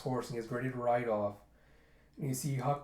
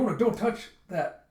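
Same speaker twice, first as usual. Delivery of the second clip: higher, louder urgent call; alarmed warning; 7 semitones higher, 7 dB louder than usual. Acted. Cook warning girl grabbing hot pot, alarmed. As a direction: stop her with a sharp warning.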